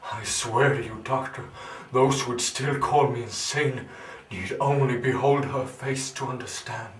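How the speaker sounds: loud, impassioned insistence; fervent oath. Acted. Swearing to the doctor about his wife's face, fervently. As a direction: swear it with rising fervour.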